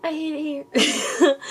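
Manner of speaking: high, drawn-out mimicked catchphrase; imitating a quote. Reader echoing a quote she can't place, in high drawn-out mimicry. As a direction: stretch it out high, like a half-remembered quote. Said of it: "Like, where's that even from?".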